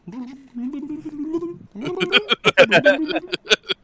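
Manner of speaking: high-pitched wordless burbling, breaking into laughter; imitated Aquan gibberish. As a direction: burble high like underwater speech. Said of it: "all you guys heard was".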